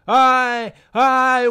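high, loud lamenting wail. Acted. Uncle lamenting his nephew's madness, wailing loud. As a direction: cry it out in despair. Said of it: "wailed Pornos".

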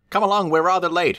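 high cheery engine voice; Thomas calling. A narrator's reading. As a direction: call out brightly, high. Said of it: "he'll call".

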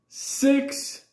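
high, loud "Six"; father blurts out the missing six; mock shout. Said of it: "my father said".

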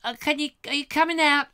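high-pitched voice at the door; child caller. Acted. Kid calling at the back door, high child voice. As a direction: ask like a kid calling for a friend.

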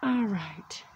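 breathy hushed whisper. Real, not acted. Whispering to viewers before opening the chimney door. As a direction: whisper it like a secret reveal.

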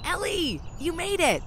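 put-on high, bright-eyed hello to an old friend; excited greeting. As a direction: greet her like you've missed her for ages.

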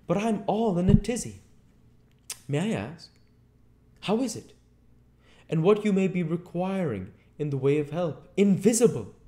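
very high, loud, agitated character voice; flustered Marvel. A narrator's reading. Marvel asks the invisible man what he wants, flustered. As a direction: blurt it out high, all flustered.

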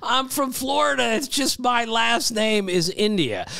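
insist, exasperated, it's your surname; emphatic denial. Retold or imitated. Player insisting to the host he's from Florida, high and emphatic. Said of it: "And he says".

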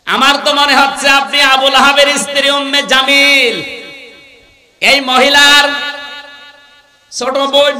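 loud, high sermon chant; preaching at full force. Real, not acted. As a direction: project it high to the whole crowd.

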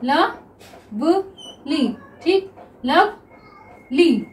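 Lovely stretched out very slowly; slow drawn-out reading. Shown as a demonstration. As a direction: draw out lovely for the class. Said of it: "next देखिए lovely". (Lovely को धीरे-धीरे खींचकर)

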